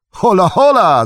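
high, loud hail from afar; loud, high-pitched call across the road. A narrator's reading. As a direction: holler to catch their attention. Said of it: "zawołał na ich widok".

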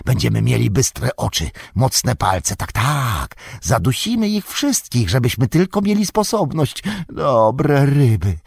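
high, slow creature voice with drawn-out vowels; hissy, whining muttering to oneself, stretched and high; Golum. A narrator's reading. Golum alone with his fish, lamenting the lost treasure, high hissing murmur. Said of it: "rozległ się świszczący szept".

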